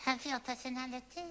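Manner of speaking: soft, trailing repetition; mock trance murmur; entranced echo. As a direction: repeat it softly as if hypnotised. Subject echoes hypnotist in trance.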